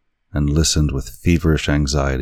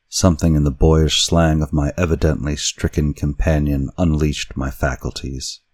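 ordinary delivery

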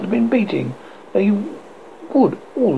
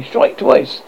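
firm; 7 semitones higher than usual. A narrator's reading. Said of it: "he said firmly".

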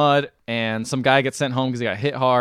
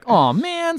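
mock dismay. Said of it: "So he's like".

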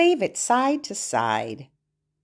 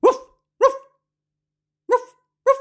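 dog bark; 5 dB louder, much faster than usual. Pretend. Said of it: "What do dogs say".

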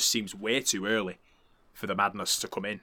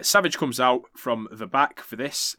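ordinary talk, nothing marked

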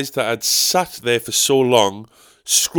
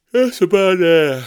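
still yawning; 8 semitones higher, 7 dB louder, slower than usual. Real, not acted. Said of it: "I'm yawning".

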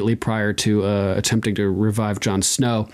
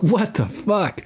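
astonished; 9 semitones higher, slower than usual. Retold or imitated. Thoros astonished God replies.

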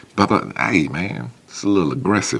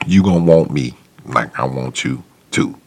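usual speaking voice

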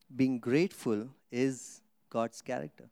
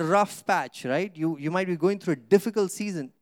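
normal talking voice

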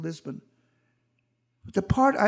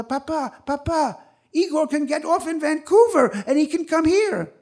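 put-on urgent child's plea; 7 semitones higher, 4 dB louder than usual. Boy begging father to save Igor.

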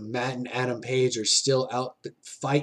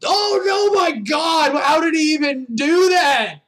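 shouted, high, in disbelief; excited shouting; 13 semitones higher, 6 dB louder than usual. Real, not acted. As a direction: yell it out, amazed by the move. Stunned by a top rope move.

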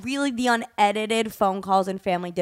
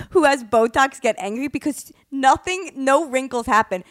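ordinary delivery

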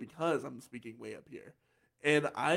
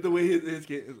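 normal talking voice

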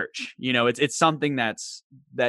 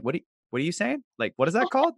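quick questions climbing higher; puzzled coworkers; 5 semitones higher, much faster than usual. Retold or imitated. Coworkers at the coffee shop asking him, puzzled. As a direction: imitate baffled coworkers, rushed and rising. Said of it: "my coworkers at the coffee shop being like".

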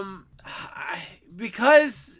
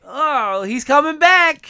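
excited; 5 semitones higher, 7 dB louder, slower than usual. Real, not acted. Excited reaction to news.

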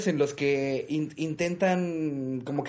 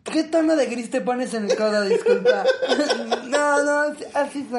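laughing; 9 semitones higher, 3 dB louder than usual. Pretend. Mock Frenchwoman, high and laughing.

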